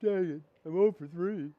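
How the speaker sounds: a hint of a moping batter; mock sulking player. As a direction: grumble the way losers do. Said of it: "Do I go like this?".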